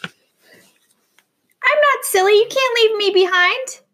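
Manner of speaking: very high, slow, whiny little voice; tiny whiny voice. Acted. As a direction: squeak it out like a tiny dot. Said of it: "a teeny tiny weeny whiny voice cried out".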